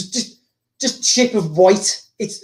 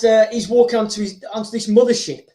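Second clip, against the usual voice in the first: ordinary talk, nothing marked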